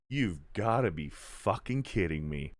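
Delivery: low, soft, fed-up aside; quiet, weary incredulity; deadpan disbelief. Real, not acted. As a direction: drop the volume, utterly unimpressed. Disbelief at the plot convenience.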